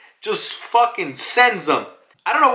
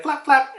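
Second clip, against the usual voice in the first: high chirpy flap; 11 semitones higher than usual. Retold or imitated. Pigeon flapping.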